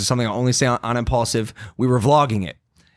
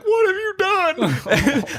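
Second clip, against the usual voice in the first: very high, slow, laughing owl voice; owl's accusing stare; much slower than usual. Pretend. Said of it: "looking at me like".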